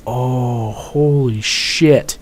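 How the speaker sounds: soft, very slow, drawn-out "holy shit"; hushed, stretched-out amazement; stunned awe. Retold or imitated. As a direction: let it sink in, quietly floored. Seeing smashed tube in mud.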